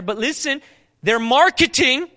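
high, loud, slowed warning; preaching emphasis. Acted. Preacher warning listeners about marketed books, emphatic. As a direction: lean in and warn them.

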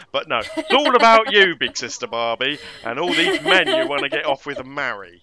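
loud mock complaint to sister Barbie; mock indignant. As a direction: scold her like a jealous little sibling. Mock scolding big sister Barbie.

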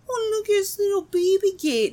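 put-on high fast cooing baby talk. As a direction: coo like talking to a toddler. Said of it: "with the baby voice".

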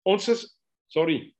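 ordinary talk, nothing marked

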